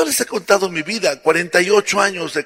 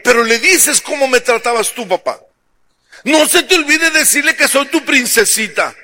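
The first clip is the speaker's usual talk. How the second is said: whiny daughter; 6 semitones higher than usual. Acted. Daughter pleads again.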